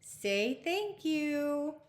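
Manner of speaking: high, very slow instruction; prim correction. Acted. Princess telling the beastie to say thank you, slow and high. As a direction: spell out the manners lesson.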